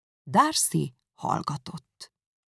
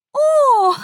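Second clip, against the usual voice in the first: magas, hangos felkiáltó ó; meglepett, éles kiáltás; felkiáltás; 10 dB louder than usual. Acted. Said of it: "kiáltotta Miss Bingley". (magas, hangos felkiáltás)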